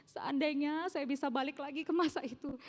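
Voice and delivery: usual speaking voice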